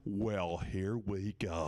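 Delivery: slow, stretched-out retold line; drawn-out announcement. Comic retelling himself as the edible hits, slow announcement. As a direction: draw each word out slowly. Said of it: "and I go".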